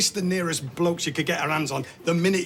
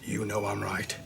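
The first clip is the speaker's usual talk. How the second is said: lower, softer parting warning; subdued warning; 6 semitones lower, 6 dB quieter than usual. Acted. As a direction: drop the voice and let the warning land. Father's parting warning after quarrel.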